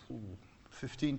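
ordinary talk, nothing marked

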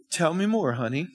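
soft, tender spouse voice; gentle reply. Acted. Spouse answering a partner without retaliating, gently. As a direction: drop it soft and sweet.

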